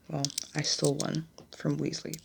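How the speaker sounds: usual speaking voice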